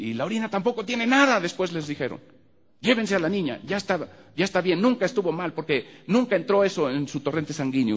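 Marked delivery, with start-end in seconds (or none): 0.1-1.4 high, loud angry rebuke; scolding doctor. Retold or imitated. Doctor scolding the parents over the clean tests, high and loud. 2.8-7.9 high, loud angry rebuke; scolding doctor. Retold or imitated. Doctor scolding the parents over the clean tests, high and loud.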